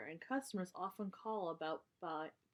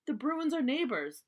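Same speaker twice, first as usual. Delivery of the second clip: arguing; 6 semitones higher, 7 dB louder than usual. Acted. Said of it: "argued Sister".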